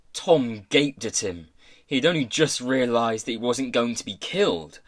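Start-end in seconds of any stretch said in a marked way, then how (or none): none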